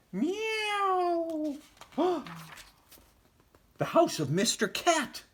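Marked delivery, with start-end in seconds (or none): 0.1-1.5 high, far-off cat meow. Acted. Cat at the door. 2.0-2.5 sharp, startled gasp; frightened gasp. Acted. Mouse frightened.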